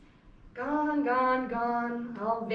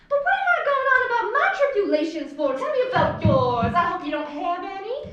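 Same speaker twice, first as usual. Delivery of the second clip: fast, high and louder chatter; gushing hostess; 7 semitones higher, 5 dB louder than usual. Acted. Amanda fussing over Jim, gushing high and fast. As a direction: pour it out brightly and quickly.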